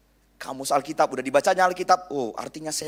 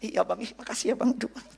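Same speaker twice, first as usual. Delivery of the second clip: higher, lightly voiced coy girl; hint of a coy girl; 10 semitones higher than usual. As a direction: play a lightly coy girl squealing at the guy.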